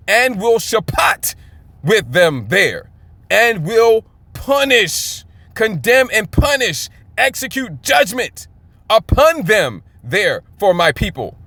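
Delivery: slow, emphatic proclamation; forceful scripture reading. Real, not acted. Preacher bringing out the verse's strength, forceful proclamation. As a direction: pronounce each phrase slowly like a sentence passed. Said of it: "brought out some of the strength".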